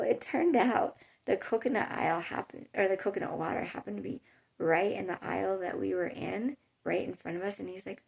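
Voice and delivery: ordinary delivery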